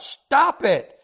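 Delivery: high-pitched mock child's shout; high protest, without full volume; terrified, angry shout. A narrator's reading. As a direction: act out a little girl's outraged cry. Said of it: "she would shout".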